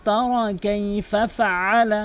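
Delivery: slow, melodic tajweed recitation; Quran recitation. Acted. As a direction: chant it slowly, holding the long vowels. (সুরেলা ধীর কোরআন তিলাওয়াত)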